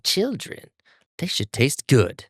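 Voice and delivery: high-lilted, drawn-out demon voice; hungry demon. A narrator's reading. Demon sizing up children to eat, hungry and drawn-out. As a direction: start high, then savour it slowly like a demon.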